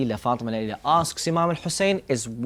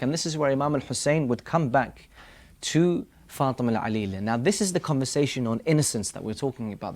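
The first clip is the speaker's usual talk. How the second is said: ordinary talk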